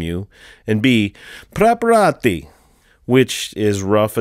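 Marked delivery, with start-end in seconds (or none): 1.5-2.5 hammed-up Italian, high and loud; mock Italian accent. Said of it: "terrible sounding Italian pronunciation".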